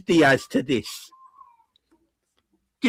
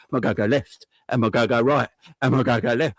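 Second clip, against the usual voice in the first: unsure winger, hammed-up lower; mock dithering footballer, a bit lower; Cody impression; 7 semitones lower, faster than usual. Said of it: "this is my Cody impression".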